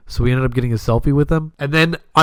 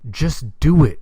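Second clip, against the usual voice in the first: ordinary talk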